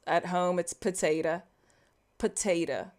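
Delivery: ordinary talk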